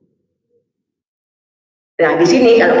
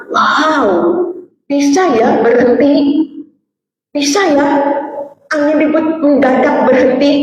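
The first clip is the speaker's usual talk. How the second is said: mock amazement; 5 semitones higher than usual. Disciples amazed.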